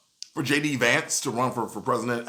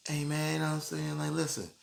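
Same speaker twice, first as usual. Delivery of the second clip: softer, laid-back drawling rapper voice; 5 dB quieter, faster than usual. Retold or imitated.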